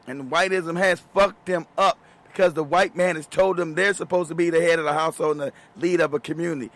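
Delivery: ordinary talk, nothing marked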